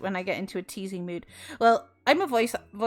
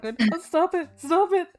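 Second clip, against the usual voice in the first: higher, laughing "stop it"; bashful giggling plea; flustered laughing protest; 9 semitones higher, 3 dB louder than usual. Retold or imitated. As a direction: squirm and laugh it off. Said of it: "he was just like".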